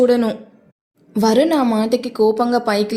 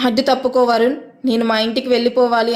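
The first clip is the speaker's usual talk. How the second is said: usual speaking voice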